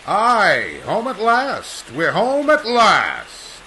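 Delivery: loud, slow and high, savouring each word; triumphant return. Acted. Aviator lands and announces his return, loud and slow. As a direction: proclaim your homecoming.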